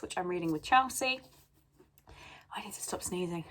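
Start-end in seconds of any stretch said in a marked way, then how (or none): 2.5-3.4 whispered aside to herself; whispering. Real, not acted. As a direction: whisper it under your breath. Aside to herself between sneezes.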